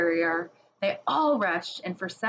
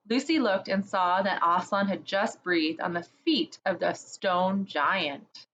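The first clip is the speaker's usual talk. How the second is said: ordinary delivery, nothing marked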